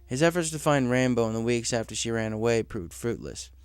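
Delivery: ordinary delivery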